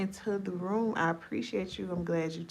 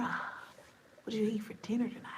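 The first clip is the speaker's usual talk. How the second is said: whisper; 5 dB quieter than usual. Real, not acted. Whispered aside to viewers.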